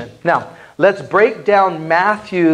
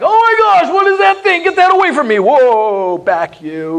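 much higher, louder shriek of mock alarm; mock horrified patient; 9 semitones higher, 3 dB louder than usual. Hospital patient shrinks from the pastor's Bible, hammed-up fright. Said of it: "they're like".

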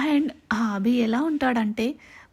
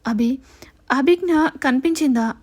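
louder, anxious hopeful plea; hopeful asking; 2 semitones higher, 6 dB louder than usual. A narrator's reading. Mother asks husband if daughter was found, louder hopeful plea. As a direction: ask the husband with rising hope, louder. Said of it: "ఆశగా భర్త వైపు చూస్తూ అడిగింది".

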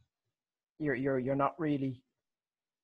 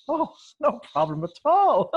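higher and louder, hammed-up eager yes; eager agreement; 10 semitones higher, 6 dB louder than usual. Fan asks for an obscure impression, hammed-up eager yes. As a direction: chirp it like a gushing people-pleaser. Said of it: "and I'm like".

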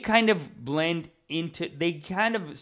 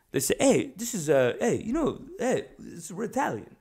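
higher-pitched mock Woodbridge Italian accent; 5 semitones higher than usual. Woodbridge Italians announcing they're Italian, mock Italian accent. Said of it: "they talk like this".

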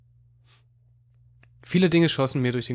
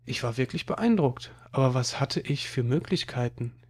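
usual speaking voice

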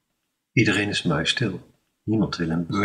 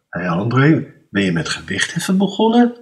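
plagend; 4 semitones higher, 5 dB louder than usual. Acted. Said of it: "kan het niet laten te vragen".

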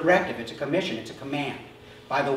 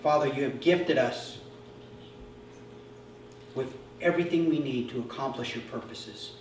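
soft, lower, reverent prayer voice; closing prayer; 3 semitones lower, 7 dB quieter than usual. Acted. Pastor leading the congregation in a closing prayer, hushed reverent. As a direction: pray quietly over the church.

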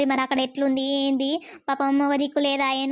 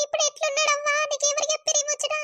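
crying; 10 semitones higher, faster than usual. Acted. Crying daughter asks about father.

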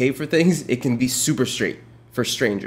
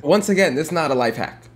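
normal talking voice

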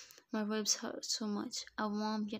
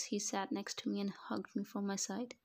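plain reading voice

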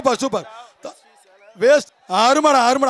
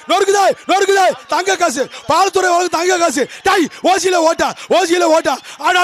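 excited commentary; 4 semitones higher than usual. Real, not acted. Jallikattu commentary, held shouting.

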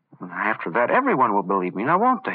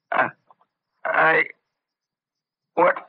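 choking on poison; 11 semitones higher, much slower than usual. Acted. Poisoned villain gasping threats at the doctor, strangled rage. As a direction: choke out each word as the poison takes hold. Poisoned man chokes and rages.

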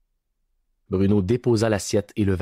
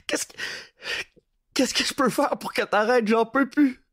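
much higher, wailing plea; on the verge of tears. A narrator's reading. Terrified prisoner begs Bruno to stop, wailing. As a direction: beg for your life near tears. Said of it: "au bord des larmes, il gémit".

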